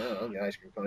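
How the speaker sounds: soft, breathless offer; whispered. Retold or imitated. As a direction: say it almost under your breath. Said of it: "I'm like".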